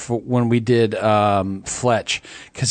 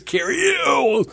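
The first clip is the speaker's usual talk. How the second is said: higher, shouted and slow name; imitating loud announcer; 13 semitones higher, slower than usual. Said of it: "All I heard was".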